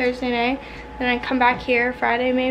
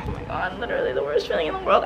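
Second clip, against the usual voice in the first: normal talking voice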